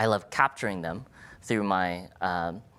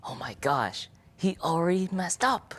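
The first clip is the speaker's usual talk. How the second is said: higher, mock-dismayed aside; imagined audience thought; 9 semitones higher than usual. Voicing the audience thinking the title is a typo. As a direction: play the doubting listener lightly. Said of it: "You may have thought".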